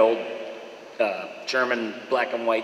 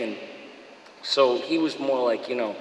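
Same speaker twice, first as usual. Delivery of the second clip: ordinary talk, nothing marked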